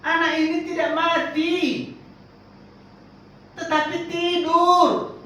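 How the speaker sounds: Jesus speaking. Acted. Quoting Jesus' words.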